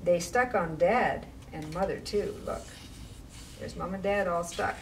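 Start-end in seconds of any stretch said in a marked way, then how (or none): none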